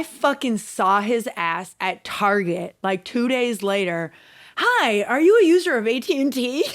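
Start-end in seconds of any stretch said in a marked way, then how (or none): 4.6-6.6 bright, louder, higher sales pitch; salesman greeting. Retold or imitated. The fake Irish guy pitching at Target, mock sales voice. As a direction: chirp it like a store salesman.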